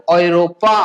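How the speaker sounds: औरोपा as "oy-ro-paa", last syllable held; German Europa. Shown as a demonstration. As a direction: model औरोपा low and syllable by syllable. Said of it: "Europe को क्या बोला जाता है".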